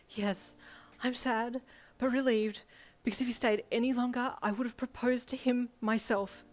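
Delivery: high, loud overwrought fangirl; wheezing clerk. A narrator's reading. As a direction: gush it out, overcome by a crush. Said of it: "she wheezed out her co-worker's name".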